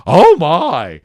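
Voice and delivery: loud, high shocked gasp; toned-down gasp. Acted. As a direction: still too big, trying to tone down. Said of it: "I just go".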